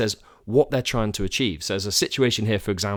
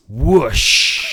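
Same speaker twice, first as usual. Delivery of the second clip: whoosh sound effect; 3 semitones higher, 8 dB louder than usual. Shown as a demonstration. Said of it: "It should just be called chat".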